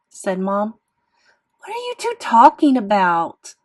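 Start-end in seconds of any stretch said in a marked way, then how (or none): 1.6-3.6 high, loud frustrated shout. A narrator's reading. Boy interrupting mother and sister, shouting. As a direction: shout to break into their argument. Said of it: "I shouted".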